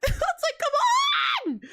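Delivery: laughing exasperated yell; exasperated shout. Real, not acted. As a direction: burst out in exasperation. Exasperated outburst at critics.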